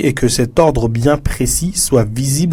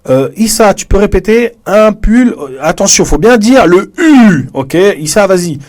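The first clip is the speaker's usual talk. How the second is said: teacher calling on a student; 8 semitones higher, 4 dB louder than usual. Retold or imitated. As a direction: call on Issa like a teacher in class. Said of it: "Je vais dire". (professeure qui interroge un élève, voix plus haute et forte)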